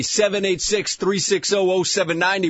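ordinary talk, nothing marked

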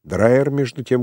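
ordinary delivery

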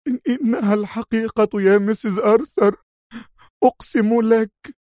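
high, stammering desperate oath; breaking down. Acted. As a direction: swear it's true, falling apart. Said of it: "هتفت المربية في انهيار".

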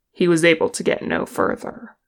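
ordinary delivery

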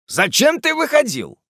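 high-pitched demand for an explanation; stern questioning. Acted. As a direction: interrogate the servant sharply. Master questions the servant sternly.

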